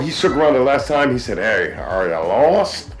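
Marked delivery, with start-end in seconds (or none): 1.4-2.9 low, slow country drawl; big country accent. Retold or imitated. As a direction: imitate a country stranger, stretched slow and low. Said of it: "With that big country accent".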